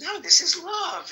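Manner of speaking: hammed-up comic yelling; mock Kinison shout. Friend imitating the comedian's bit, mock shout. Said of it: "He said".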